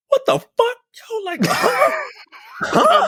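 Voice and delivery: genuinely floored, voice leaping up each time; stunned disbelief. Real, not acted. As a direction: yelp out a real "what the fuck, huh?". Stunned by absurd movie line.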